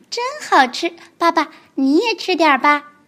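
高高的童声，天真认真; 小孩一本正经地解释; 天真的小恐龙. Acted. 小恐龙角色对白. (天真的高音童声)

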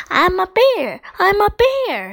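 put-on high, loud childlike cry; excited shout. A narrator's reading. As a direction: shout it proudly, pitch up high. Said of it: "shouted Alfie".

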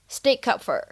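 "stick up for" run together, k linked into "up", p unreleased; linked, unreleased stop. Shown as a demonstration. Teacher shows learners "stick up for" linked, with p unreleased. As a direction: link "stick" to "up" and hold the p before "for". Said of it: "前两者呢可以做连读".

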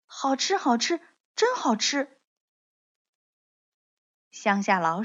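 cheerful storybook relish; happy. A narrator's reading. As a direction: savour it with mild delight. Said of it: "高兴地说".

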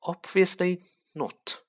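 lightly voiced sneering aristocrat, slow drawn-out greeting; a hint of cold contempt, savouring the name; sneering Lucius Malfoy. A narrator's reading. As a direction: greet your rival with a slow, mild sneer. Lucius mocks the old book.

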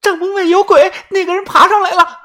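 high-pitched panicked wail; frightened cry. Acted. Waking companion terrified of a ghost outside the tent, frightened cry. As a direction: cry out in terror, voice pitched right up.

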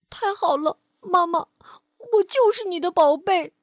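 high, loud, teary joy; crying child comforted by mum; relieved through tears. Acted. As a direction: smile through the sobs.

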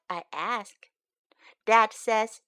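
put-on lively storybook read-aloud for kids; picture book read to young learners; reading to children. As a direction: read it brightly to little listeners.

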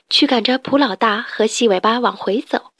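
ordinary delivery

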